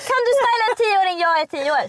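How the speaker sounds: put-on high, childish little voice; kid voice. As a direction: squeak it like a ten-year-old. Mocking a ten-year-old's comment. (ljus barnröst)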